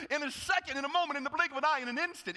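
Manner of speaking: high, fast, loud preaching; preacher racing to the punchline; fired up. Acted. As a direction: rattle it off like a preacher. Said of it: "preaching build".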